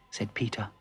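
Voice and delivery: ordinary delivery, nothing marked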